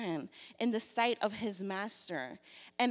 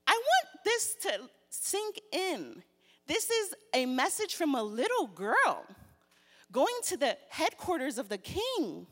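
ordinary delivery

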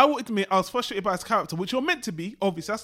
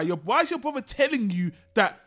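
exasperated outburst; 11 semitones higher, 5 dB louder than usual. Retold or imitated. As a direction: pitch climbing high in disbelief, louder. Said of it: "And I was just like".

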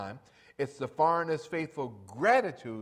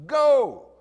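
shouted command; 12 semitones higher, 15 dB louder than usual. Acted. Climax shout.

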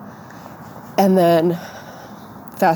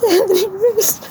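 real crying, high and slow; choked up, voice pitched way up in tears; 13 semitones higher, slower than usual. Real, not acted. Breaks down crying while telling it.

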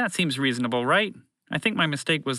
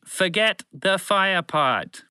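very slow, higher "forget the fire part"; emphatic repeat; 8 semitones higher, 3 dB louder, much slower than usual. Acted. Broadcast voice repeating the order to intruders, slow and emphatic.

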